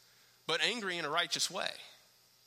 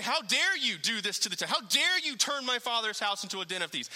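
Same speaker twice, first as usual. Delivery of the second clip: mock yell; 6 semitones higher, 3 dB louder than usual. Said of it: "He's yelling at 'em".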